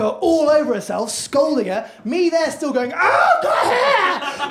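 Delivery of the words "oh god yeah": very high, loud panicked yelp; flustered cry. Retold or imitated. Man on the toilet to the woman, panicked high yelp.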